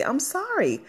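demonstrating the simple apology up high. Shown as a demonstration. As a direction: say it up high like showing how easy it is.

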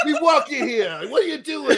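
high, loud hammed-up New York accent; exaggerated New York yell, half laughing. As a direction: do a pushy mock New Yorker accent. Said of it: "If it were New York, you would have somebody going".

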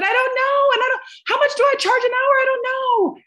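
very high, squealing comic panic; exaggerated high-pitched freak-out; mock panicked wail. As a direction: wail it helplessly, hammed-up. Acted panicked exchange with boyfriend.

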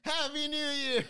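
a joking New Year's cheer at midnight; mock cheer. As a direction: stretch out a fake party shout. Mock celebration after countdown.

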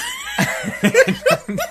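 squealing high laugh. Real, not acted. Friend stressed by the movie, high squealing laugh. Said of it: "laugh heard".

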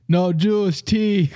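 put-on playful Jamaican-accented ad read. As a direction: read it like a laid-back Jamaican pitchman. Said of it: "read the whole thing in a Jamaican accent".

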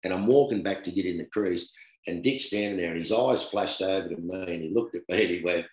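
ordinary talk, nothing marked

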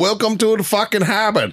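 high, mock Pakistani-Boston cabbie accent; a light, high Pakistani-Boston accent impression. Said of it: "the Pakistani that also has the accent".

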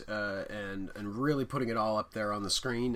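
ordinary talk, nothing marked

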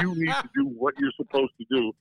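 mocking coach. Coach ripping his linebacker on the bench, high mocking voice. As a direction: sneer it like a coach cutting a rookie down.

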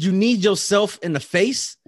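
loud, high, slow disbelief; incredulous questioning. Retold or imitated. Man checking the kid kneed himself, incredulous. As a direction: stretch it into a rising, baffled question. Acted disbelief at kid's answer.

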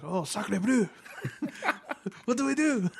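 hammed-up French accent, high and fretful; mock French CEO. Imagined French CEO, panicking in a mock French accent. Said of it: "our French C E O would have to be like".

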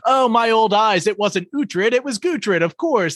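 hammed-up abbot covering his mistake, starting high and settling down; backtracking abbot. Abbot excusing his mix-up to onlookers, mock flustered. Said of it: "And he was just like".

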